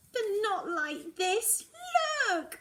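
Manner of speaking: high, loud crying howl; tearful howl, voice breaking. A narrator's reading. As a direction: wail it through tears. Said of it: "I howl".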